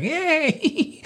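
very high falsetto yay; mock cheer. Girls jumping in unison, high falsetto cheer. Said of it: "If you've ever seen that, like".

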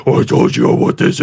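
rough, low mock rant; caller going mental. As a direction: imitate an irate caller losing it. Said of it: "crazies calling up and just going mental".

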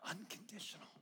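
breathy whispered Unconditional. Real, not acted. Whispered word.

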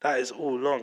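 ordinary talk

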